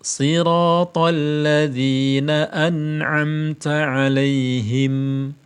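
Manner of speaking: slow, drawn-out chanted recitation; slow Quran recitation. Shown as a demonstration. Teacher recites the verse slowly for students to copy.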